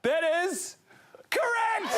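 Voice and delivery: high, ringing game-show verdict; excited announcement. Real, not acted. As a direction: call it out like a quiz host. Host announcing verdict, high pitch.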